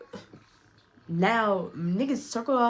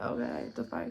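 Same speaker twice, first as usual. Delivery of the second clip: unvoiced whisper, barely audible; whispering to herself as the guy lays it on; whispered aside; 3 semitones higher, 9 dB quieter than usual. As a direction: whisper it under your breath, weirded out. Weirded out, muttering aside.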